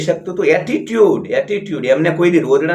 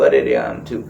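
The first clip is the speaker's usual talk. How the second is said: much lower, drooping whine that rises at the end; mock whining moan; 13 semitones lower than usual. Mocking a self-pitying elder to listeners, lower whining moan. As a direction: drag it down like a moaning complainer. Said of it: "તમે રોદણા રોશો તો".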